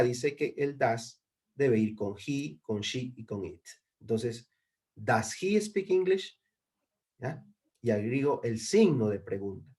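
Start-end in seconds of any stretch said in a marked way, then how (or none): 4.9-6.4 slow, raised question tune on "Does he speak English?"; question intonation. Shown as a demonstration. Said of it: "Recuerden siempre cambiar el tono".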